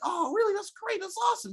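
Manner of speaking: light high squeal of retold enthusiasm; mock delighted reply. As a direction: act out being pleased at good news. Voices thrilled reply to henchman.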